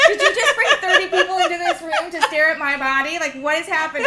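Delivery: loud, high mock outrage through laughter; hammed-up, incredulous freak-out; panicked protest. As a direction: demand answers, comically alarmed. Blindfolded freak-out, acted.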